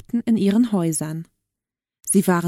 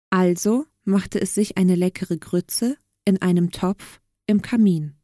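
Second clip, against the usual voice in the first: plain reading voice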